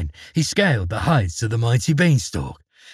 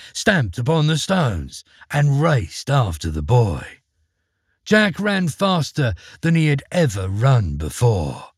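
ordinary delivery

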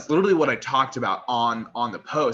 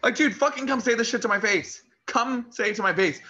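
fired-up rant; 12 semitones higher, 3 dB louder than usual. Real, not acted. Ranting at hidden critics.

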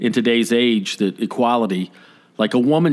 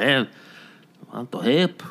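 lightly imitated Spanish accent. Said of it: "I shouldn't do the accent".

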